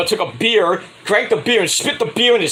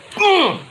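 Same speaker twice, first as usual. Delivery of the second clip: high suggestive hum; 13 semitones higher than usual. Retold or imitated.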